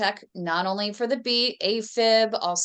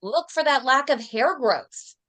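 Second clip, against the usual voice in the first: patient instructing doctor; 6 semitones higher than usual. Acted. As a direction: spell out each request to the doctor, pitched up. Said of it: "That means".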